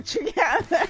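laughing. Real, not acted. Player tickled by Steven and Stephanie together, laughing. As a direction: giggle through the words. Laughing at the two characters meeting.

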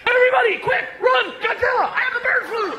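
high, frantic mock yelling; loud, high-pitched hysterical alarm; losing his mind. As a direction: shout a hammed-up panic warning to everyone. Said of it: "this Korean guy is losing his mind".

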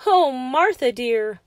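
loud, mildly hammed-up cry of distress. A narrator's reading. George cries out to his dear Martha, crying out. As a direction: call out in storybook dismay. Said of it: "George cried out".

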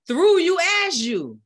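shouted high at the peak of the prayer; preacher's shout. Acted. Preacher shouting to the congregation at the climax. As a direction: belt it out to the back pews.

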